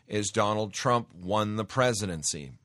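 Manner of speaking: normal talking voice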